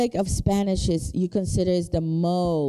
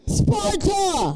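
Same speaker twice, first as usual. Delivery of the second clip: mock battle cry; 11 semitones higher than usual. Joking shout of Sparta.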